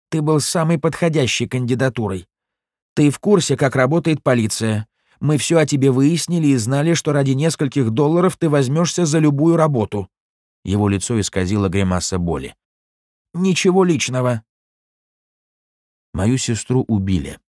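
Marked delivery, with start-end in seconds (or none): 0.1-10.1 higher, pained sneering cop; a beaten crooked cop answering; corrupt cop voice. Acted. 13.3-14.4 higher, offhand nothing personal; dismissive. Acted. Goldstein shrugs it off.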